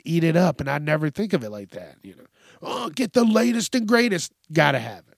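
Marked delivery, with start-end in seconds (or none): 2.6-4.3 higher, salesy pitch voice; lightly hammed-up commercial voice; mock ad hype. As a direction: parody a pushy sales pitch. Mocking advertising hype.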